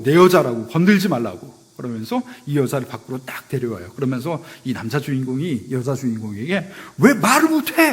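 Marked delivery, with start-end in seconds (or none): 0.0-1.7 loud, firm claim to his woman; protective declaration. Retold or imitated. Drama hero warning off rich guests, loud firm declaration. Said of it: "그 상황을 딱 정리하고". 7.0-7.9 high, loud outraged question; furious demand. Retold or imitated. Drama hero scolding heroine outside the party, high furious shout. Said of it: "막 분노하면서".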